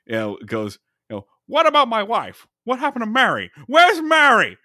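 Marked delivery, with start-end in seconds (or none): 1.5-4.6 high, pleading, rising panic; Jimmy Stewart impression. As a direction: beg like a desperate old-movie hero. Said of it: "my Jimmy Stewart impression".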